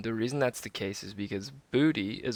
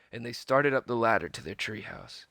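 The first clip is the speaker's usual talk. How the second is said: plain reading voice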